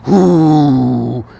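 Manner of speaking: loud imitated grunt as the mallet swings; mock effort grunt. Imitating the dignitary swinging, a loud mock grunt. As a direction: grunt it like someone heaving a big mallet. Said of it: "And the person just goes".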